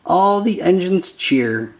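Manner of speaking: plain reading voice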